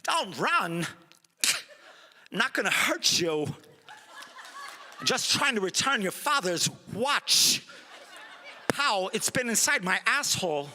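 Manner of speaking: a lightly done Walken, halting and laughing; a light Walken, laughing through it; Christopher Walken impression. Playing Michael Myers as Walken in a stoned home movie, laughing, light impression. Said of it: "as Christopher Walken".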